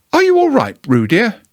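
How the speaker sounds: high, motherly kangaroo voice; Kanga's voice. Acted. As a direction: lift into Kanga, caring.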